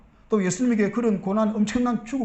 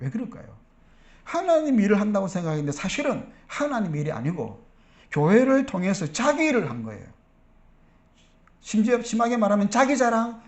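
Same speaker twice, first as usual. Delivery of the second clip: usual speaking voice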